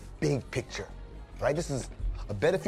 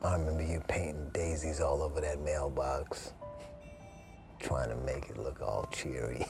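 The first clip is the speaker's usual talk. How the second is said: low, soft murmur of remembrance; wistful; 9 semitones lower, 7 dB quieter than usual. Acted. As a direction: speak gently to a lost love, almost to yourself. Reminiscing alone to deceased wife.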